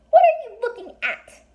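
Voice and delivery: very high, tiny, squeaky fairy voice; a little fairy snapping at strangers, high and squeaky. A narrator's reading. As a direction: speak like a fairy only inches tall. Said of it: "in a tiny, high-pitched voice".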